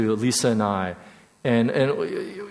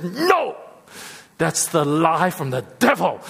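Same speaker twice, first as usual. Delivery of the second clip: emphatic rebuke; 2 semitones higher than usual. Retold or imitated. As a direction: imitate a mentor's forceful correction. Voicing mentor's firm rebuke.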